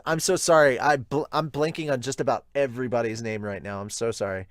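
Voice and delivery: usual speaking voice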